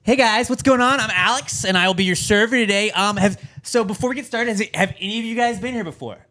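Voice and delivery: imitating an overfriendly waiter; restaurant server spiel. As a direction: recite the welcome like an eager server. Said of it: "one thing I hate at restaurants is".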